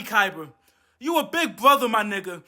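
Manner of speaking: ordinary delivery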